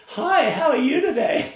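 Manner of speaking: loud, high, hammed-up American greeting; mock a gushing American shop greeter; cheesy American accent. US store worker greeting a customer, cheesy American accent. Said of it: "Sometimes it can be cheesy".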